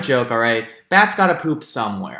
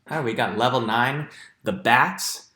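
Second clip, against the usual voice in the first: normal talking voice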